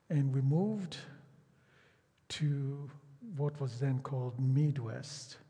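ordinary delivery

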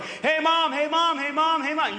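put-on high, repeated nagging child's call; whiny kid nagging. Parent mimics a kid pestering mom, high and whiny. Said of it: "And they're just like".

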